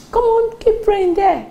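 high, drawn-out dismissive order; mock scolding. Preacher imagining God rebuking Jonah, high mock scolding.

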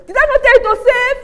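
loud, high scolding of a foolish man; told-you-so scolding. Retold or imitated. Relative scolding the jobless man, loud and high. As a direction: scold him loudly for not saving. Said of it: "He said".

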